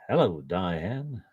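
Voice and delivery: put-on slow, lightly done Mid-Atlantic accent; a hint of old-film Mid-Atlantic charm, slow; Cary Grant. Praising thirties film accents, light slow Mid-Atlantic imitation. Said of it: "that wonderful Cary Grant sound".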